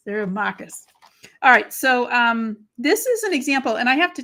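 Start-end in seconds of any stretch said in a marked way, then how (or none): 0.3-0.9 "markers" as "mahkuhz", Boston style; Boston accent. Shown as a demonstration. Crafter showing viewers where she is from, Boston accent. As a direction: drop both Rs in "markers", Boston fashion. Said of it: "my Boston accent is gonna come out".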